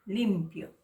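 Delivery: usual speaking voice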